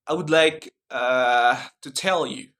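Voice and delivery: American accent, clear T in "tell". Shown as a demonstration. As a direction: demonstrate an American accent with a hesitation. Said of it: "Like in American accent".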